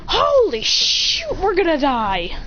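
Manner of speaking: high, drawn-out cry easing down; hammed-up alarm, stretched out slowly; mock panic. Character panics at enemy's power-up.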